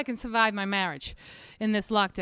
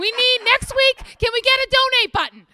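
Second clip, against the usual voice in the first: exasperated demand; 9 semitones higher, 7 dB louder than usual. Real, not acted. Exasperated demand for a donate button.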